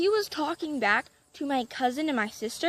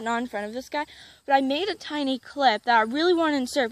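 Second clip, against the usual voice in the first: ordinary delivery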